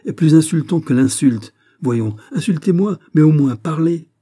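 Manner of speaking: loud, high furious demands; trembling fury. A narrator's reading. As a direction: hurl the challenge at him. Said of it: "en tremblant de fureur".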